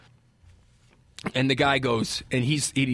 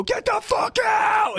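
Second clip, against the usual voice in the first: long, high-pitched eviction yell; furious retold shout; much slower than usual. Storyteller throwing a jersey out the door, furious shout. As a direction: holler it out, stretching the words.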